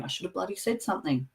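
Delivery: quick, hushed muttered regret; muttering to myself. Retold or imitated. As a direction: grumble it quickly to yourself, barely audible.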